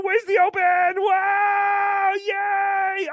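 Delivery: high shouted cheers; excited shout. Real, not acted.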